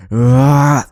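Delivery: piercing high scream, very loud; monstrous high-pitched howl of pain; statue's scream. A narrator's reading. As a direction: let out an inhuman shriek. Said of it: "the statue screamed".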